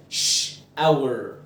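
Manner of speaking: shower split slowly into ش and اور; sounding out shower. Shown as a demonstration. Teacher sounds out shower in two parts for students.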